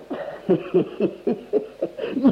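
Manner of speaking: high breathy giggle, pleased with himself; high sly giggle. Acted. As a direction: giggle at your own cunning. Host laughs at own trick. (ضحكة ماكرة)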